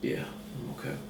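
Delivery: hushed, choked mock tears; mock emotional. Reviewer tells co-host he's fine, hushed mock tears. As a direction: pretend the food made you cry. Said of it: "I'm getting emotional".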